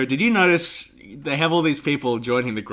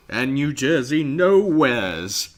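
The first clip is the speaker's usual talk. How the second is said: slow character voice; 2 semitones higher, 4 dB louder, much slower than usual. Retold or imitated. Quoting the character slowly.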